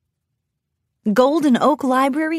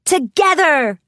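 raised, high rallying shout; 6 semitones higher, 5 dB louder than usual. A narrator's reading. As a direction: call it out loud and decisive. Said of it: "shouted Rarity and Fluttershy".

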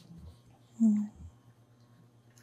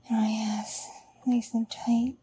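whispering. Acted. Intimate whispered confession.

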